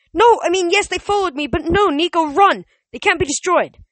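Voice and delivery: high, loud urgent protest; frantic warning. A narrator's reading. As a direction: shout over the danger, stumbling to explain. Frantic warning as skeletons attack.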